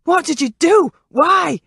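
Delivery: high, loud anguished shout. A narrator's reading. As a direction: yell it out in shock at the killing. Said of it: "Craig began shouting".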